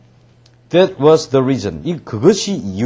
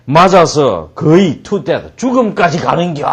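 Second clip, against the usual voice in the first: loud, raised mock menace; giant threat; 4 semitones higher, 6 dB louder than usual. Giant threatening trespassers with a beating, loud bellow. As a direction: bellow a hammed-up threat, drawing out the end.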